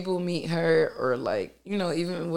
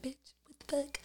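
1 mock whisper; 10 semitones higher, 10 dB quieter than usual. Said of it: "I'm saying how I would say it".

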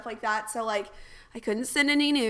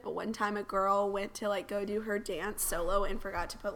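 normal talking voice

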